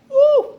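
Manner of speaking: sudden high, loud falsetto whoop; high falsetto woo. Acted. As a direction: belt a shrill mock gospel woo. Mimicking stuck singing.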